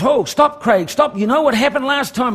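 high, urgent plea to stop; worried warning. Retold or imitated. Support person grabbing his arm, urgent high plea. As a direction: beg him to stop, alarmed. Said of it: "he grips my arm and he goes".

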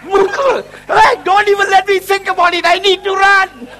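loud, high mock panic; comic urgency. Joking about Bali belly, hammed-up urgent panic. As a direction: blurt it as if caught short.

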